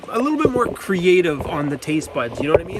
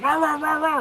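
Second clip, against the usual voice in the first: higher-pitched mock barks; imitated dog barking; 8 semitones higher than usual. Owner acting out the dog's barking at beer, mock barks. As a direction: bark like an annoyed dog. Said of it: "He was like".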